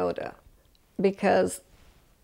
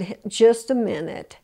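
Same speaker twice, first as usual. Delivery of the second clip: normal talking voice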